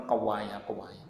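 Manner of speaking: "kewaya" drawn out with the East Javanese stretched first vowel; East Javanese word. Shown as a demonstration. "kewaya" as an East Javanese villager says it. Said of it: "bahasa Jawa, Jawa Timur".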